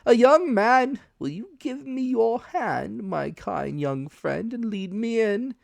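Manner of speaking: high, slow, loud creepy voice; high menacing beggar voice; sinister blind beggar. Acted. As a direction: make him eerie and grasping. Blind man asks for hand.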